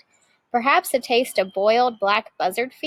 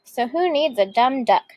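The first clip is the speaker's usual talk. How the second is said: ordinary delivery, nothing marked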